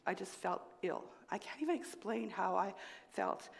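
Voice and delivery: hushed, breathy confession; whispered. Real, not acted. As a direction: barely voice it. Recalling sudden illness.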